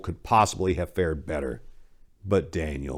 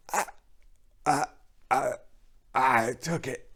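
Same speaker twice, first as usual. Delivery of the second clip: spat, stammering; 6 semitones higher than usual. Acted. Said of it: "spat Daniel".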